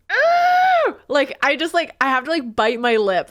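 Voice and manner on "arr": high, loud squeezed growl at the cats; a shrill burst of cute aggression; overwhelmed by cuteness, a loud strangled arr. Retold or imitated. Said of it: "just be like".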